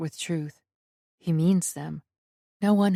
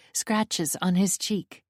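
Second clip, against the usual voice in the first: ordinary delivery, nothing marked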